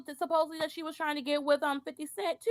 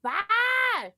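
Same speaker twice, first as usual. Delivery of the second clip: sassy dismissal; 7 semitones higher, 7 dB louder than usual. Real, not acted. Dismissive sass.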